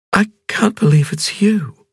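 high, slow woman's voice, amazed; dazed wonder at meeting him; young woman Ping. A narrator's reading. Ping to Cavalier after the crash, high slow amazed voice.

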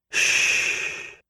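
soft, drawn-out shh; long breath of a shush; soothing shush. A narrator's reading. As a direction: hush someone gently. Husband hushing crying wife.

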